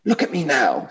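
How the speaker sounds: a self-righteous ghost voice pushed up high; indignant big ghost. Acted. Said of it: "I'll try vaguely to put on different voices".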